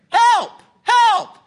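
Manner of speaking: high, loud cries for help; short high-pitched shouts; yelling for help. Acted. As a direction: yell it out, desperate to be heard. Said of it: "So Jack starts yelling".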